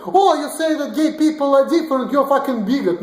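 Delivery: high, whiny mock outrage; mocking Americans. Mimicking Americans who call him a bigot, high whiny outrage. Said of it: "You're like".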